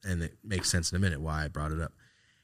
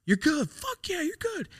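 much higher, hammed-up pep cheer; hyping him up; slower than usual. Keeping his sick uncle's spirits up, squeaky high hype. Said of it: "I'm trying to keep him like".

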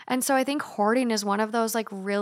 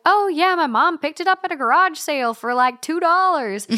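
higher, louder mock-excited guest; chirpy show guest; 7 semitones higher, 6 dB louder than usual. Antiques show guest telling where mom got it, mock chirpy. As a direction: play up a guest's casual pride, pitched high.

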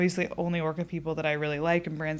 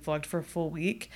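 ordinary talk, nothing marked

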